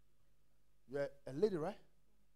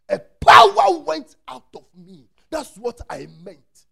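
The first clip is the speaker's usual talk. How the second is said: much louder, higher and slow; slow loud declaration; 5 semitones higher, 18 dB louder, slower than usual. Retold or imitated. Jesus telling Peter power left him, slow and loud. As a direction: proclaim it slowly and powerfully. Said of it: "They said".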